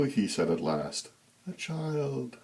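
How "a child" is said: a creepy high falsetto ooh; eerie high ooh. A narrator's reading. Creepy Santa spotting a child, high eerie coo. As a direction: coo it high and unsettling like a hungry thing. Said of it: "His voice was a high tenor with breathy overtones".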